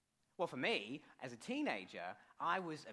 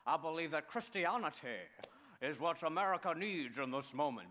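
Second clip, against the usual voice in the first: lightly imitating a Texas pastor's odd pronunciation; a touch of a Texas televangelist; Texas preacher impression. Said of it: "he always had this really weird way of pronouncing things".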